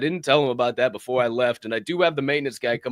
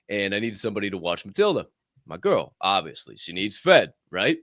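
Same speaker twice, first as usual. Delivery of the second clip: ordinary delivery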